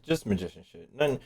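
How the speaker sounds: normal talking voice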